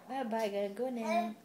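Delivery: ordinary talk, nothing marked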